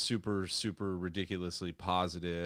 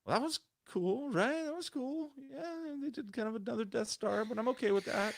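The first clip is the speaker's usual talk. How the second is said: high-pitched, acted-out inner voice; high voice of tentative approval; half-convinced reaction. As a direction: pitch it way up, talking yourself into it. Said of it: "I did leave kinda like".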